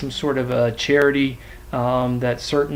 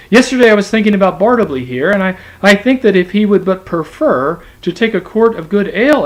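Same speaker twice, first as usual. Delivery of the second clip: high, fast, eager clerk's voice; fawning servant rattling on, raised and quick; deferential clerk; 5 semitones higher, 6 dB louder than usual. Acted. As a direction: play the humble, hurried clerk. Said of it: "Turkey blandly and deferentially approached".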